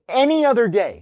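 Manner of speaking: "any other day" pitched high and punched; emphatic. Real, not acted. Husband explaining why he snapped that day, emphatic. Stressing it was a bad day.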